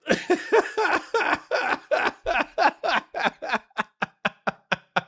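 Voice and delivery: long high laugh trailing into wheezes; helpless laughter. Real, not acted. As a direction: laugh until no air is left. Still cracking up.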